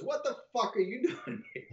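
high-pitched incredulous question; mock disbelief, pitched well up; retold astonishment. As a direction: imitate someone baffled to find you here. Ailes startled to find him there.

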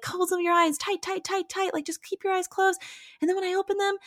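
put-on high, hushed mother-to-child urging; retold hypnosis coaxing. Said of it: "doing her own hypnosis moment".

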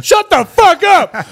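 high, drawn-out heckling shout; homeless guy yelling. Acted. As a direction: holler it from the street to shut up a singer. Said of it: "a homeless guy outside going".

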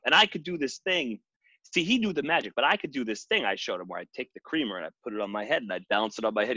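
normal talking voice

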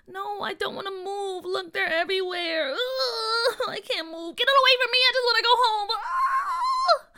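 high, loud tearful whine; crying. Acted. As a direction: cry and refuse to move. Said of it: "McKinley cried".

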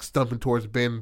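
ordinary talk

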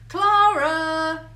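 loud call over the hedge, drawn out. A narrator's reading. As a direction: shout the name across the maze. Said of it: "he called out over the top of the hedge".

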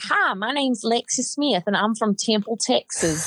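put-on Southern accent. Imitating her college teammate's Southern accent intro. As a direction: introduce yourself like a small-town Texas girl. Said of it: "she has just the Southern accent".

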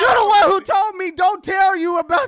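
high, loud comeback; worked-up, yelled protest; indignant shouting. Real, not acted. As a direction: fire the blame back at your co-host. Shouting back at co-host.

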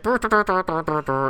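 high buzzing trumpet noises; rapid high brr toots; imitated trumpet. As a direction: mouth a little trumpet tooting. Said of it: "a guy's playing a trumpet".

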